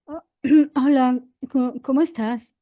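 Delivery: throat-clearing, stumbling hello; awkward greeting. Shown as a demonstration. Coach showing a flustered greeting, clearing throat and stumbling. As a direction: fumble the hello nervously. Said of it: "Date cuenta de qué diferencia".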